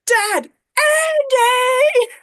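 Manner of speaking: high, loud, drawn-out call; yelling across airport. Retold or imitated. Son calling his dad across the airport, a long loud yell. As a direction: stretch each name to reach across the hall. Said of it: "he's yelling out across the whole airport".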